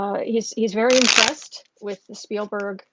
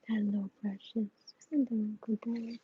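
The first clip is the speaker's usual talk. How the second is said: cooing to a pet; 8 dB quieter than usual. Real, not acted. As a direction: coo softly at a beloved pet. Cooing to her pet dog.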